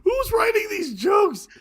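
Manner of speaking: high, loud, drawn-out yell; amused outcry. Real, not acted. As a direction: shout it in helpless disbelief. Outburst after laughing hard.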